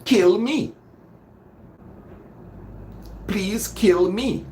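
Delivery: high "Kill me", slow "Please kill me"; sounds like a command. Shown as a demonstration. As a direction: say it as the order it becomes. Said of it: "this is a command".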